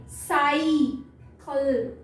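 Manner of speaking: साइकिल slow, "sai" held, then "kal"; long I, syllabified. Shown as a demonstration. Teacher reading by the open-vowel rule, साइकिल slowly split. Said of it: "इसको यहाँ long आई पढ़ेंगे".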